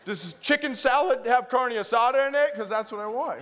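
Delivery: half-laughing comic sulk; laughing mock protest. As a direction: demand it with a laugh. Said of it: "I was like".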